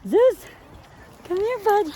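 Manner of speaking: high, eager call to the pup; calling the dog. Real, not acted. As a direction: lure the dog over with a lifted voice.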